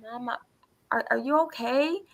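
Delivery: put-on soft, high child's question; worried son. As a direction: imitate a son timidly checking on mom. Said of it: "My son comes in, and he says".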